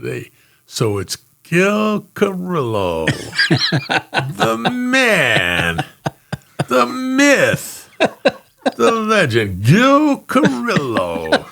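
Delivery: put-on slow, high, slurred drunk praise; drawn-out drunken toast over the phone; hammed-up drunk, laughing; drunk partner.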